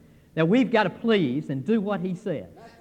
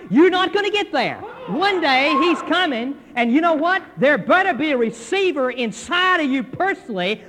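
preaching at full force; 5 semitones higher than usual. Acted. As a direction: build to a fiery pulpit cry.

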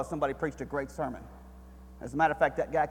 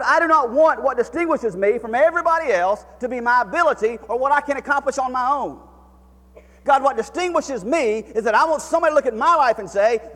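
high, loud preacher's cry to God; impassioned sermon climax; full-force preaching; 11 semitones higher, 12 dB louder than usual. Acted. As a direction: plead it from the pulpit, full voice. Preaching Moses's plea.

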